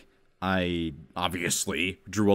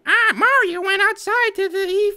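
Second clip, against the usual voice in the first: mock kid writer; 6 dB louder than usual. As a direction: read it like a clueless little kid. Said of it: "you would think it's like".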